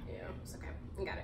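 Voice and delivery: whispered reassurance. Real, not acted. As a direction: breathe it under your voice. Muttering over her plate.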